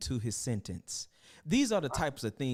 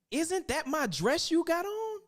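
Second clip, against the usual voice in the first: indignant mama. Acted. Mama confronting the queen.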